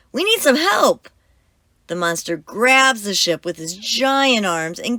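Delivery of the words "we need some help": high, loud call for help. Acted. Captain calling to the sea monster for help, high and loud. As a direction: shout across the waves. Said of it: "he yells to the sea monster".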